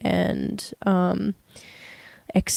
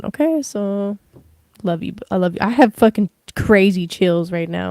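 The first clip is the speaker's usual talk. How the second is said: ordinary delivery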